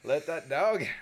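loud laughing punchline; laughing shout. Real, not acted. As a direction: belt it through a laugh. Repeats punchline louder.

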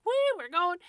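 high, loud gleeful whoop as the puppy; eager puppy. Retold or imitated. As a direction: blurt it out like a puppy charging ahead. Eager puppy voiced mid-story.

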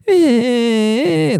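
high, slow, stretched whine; mock crying kid. Kid crying all night next door, slow high whine. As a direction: drag out a high child's whimper.

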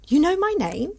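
sharp high surprised question; startled she's been recognised. Acted. Surprised he knows her name.

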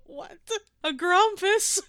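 high-pitched, baffled echo; real incredulity. Real, not acted. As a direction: squeak it back in disbelief. Listener baffled by the odd insult.

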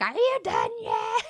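high yell across the store; yelling at husband. Retold or imitated. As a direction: call out impatiently, high and loud. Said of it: "yell over at her husband".

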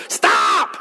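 "Stop!" shouted as a sharp command; Samuel's stern stop. Retold or imitated. Samuel cutting off Saul's excuses, shouted "Stop!". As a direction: bark "Stop!" at him like an old prophet. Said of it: "Can you just imagine Samuel looking at Saul and going".

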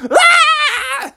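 very high mock shriek; terrified scream. Parents scream at the intruding clown, terrified. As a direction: scream high in cartoon fright.

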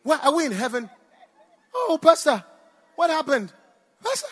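high, loud panicked questions; frantic, can't believe what she sees; woman waking from surgery, frantic and loud. Retold or imitated. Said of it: "she went into panic mode".

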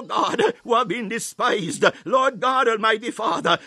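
ordinary talk

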